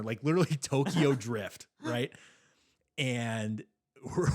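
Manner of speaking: ordinary talk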